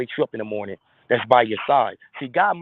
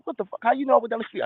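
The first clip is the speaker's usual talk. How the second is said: put-on high, startled kid voice; shocked young kid; 9 semitones higher, faster than usual. Young son caught out by his dad, high and startled. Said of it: "didn't you".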